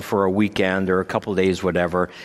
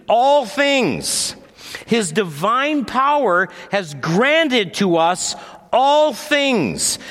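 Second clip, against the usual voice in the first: preaching; 10 semitones higher than usual. Acted. Preaching passage on divine power.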